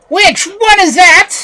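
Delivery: high, slow, shouted demand; witch's shout. A narrator's reading. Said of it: "shouted the witch".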